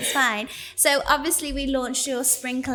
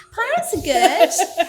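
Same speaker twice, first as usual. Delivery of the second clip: laughing; 8 dB louder, slower than usual. Real, not acted. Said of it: "I just sound like a pirate".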